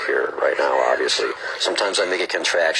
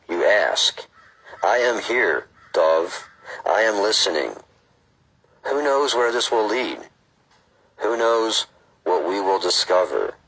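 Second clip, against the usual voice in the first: slow, measured read-aloud; book reading; 4 semitones higher, slower than usual. Acted. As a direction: read it out deliberately, phrase by phrase.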